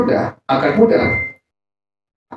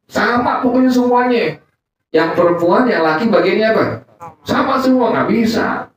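high, insistent mock protest; stubborn protest; 7 semitones higher than usual. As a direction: whine it like a stubborn older brother. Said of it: "Kata gua". (protes keras kepala, nada tinggi)

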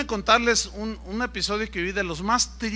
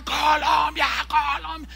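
high fan chant, fading on the repeat; crowd shouting; 8 semitones higher, 3 dB louder than usual. Retold or imitated. People in the stands cheering the team, high shouted chant. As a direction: imitate a stadium chanting for its team. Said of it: "la gente empezó a gritar".

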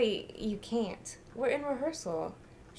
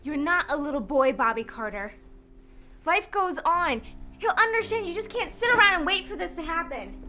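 raised, higher voice pressing the point; heated lecturing; 6 semitones higher, 5 dB louder than usual. Acted. Partner telling him life must go on, heated. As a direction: argue over the protest, loudly. Quarrel over dying father.

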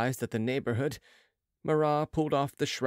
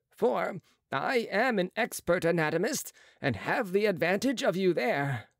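higher, brisk surgeon's voice; a proud young surgeon, pitched up; Marat's voice; 6 semitones higher than usual. A narrator's reading. Marat boasting.

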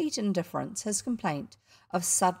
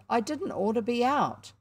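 pitched-up, mildly whiny grumble; complaining; 4 semitones higher than usual. A narrator's reading. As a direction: plead illness, complaining. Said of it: "he complained".

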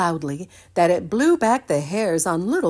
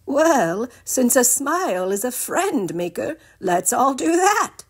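higher, louder sunny voice; cheerful Ginger voice; 8 semitones higher, 5 dB louder than usual. Acted. Ginger urging the gang to smile, high and cheerful. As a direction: sell the idea brightly. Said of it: "Ginger said".

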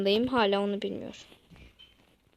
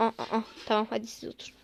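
ordinary talk, nothing marked

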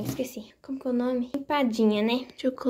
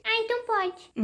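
put-on high little kid voice; high child, softer; 9 semitones higher, 4 dB quieter than usual. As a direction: kid caving for sweets. Doll gives in for candy.

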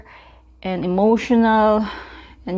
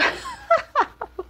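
high burst of laughter; 5 dB quieter than usual. Real, not acted. Laughing at bloopers.

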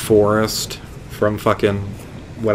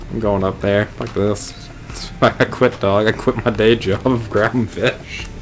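usual speaking voice

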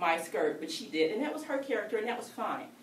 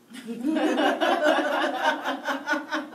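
big laugh; 5 semitones higher, 6 dB louder than usual. Real, not acted. Laughs at own story.

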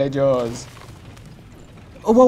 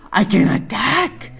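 high gasp, then excited exclamation; playfully thrilled discovery; mock amazement; 11 semitones higher than usual. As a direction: gasp and exclaim at a new power. Mock amazement at a new ability.

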